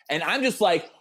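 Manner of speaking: ordinary delivery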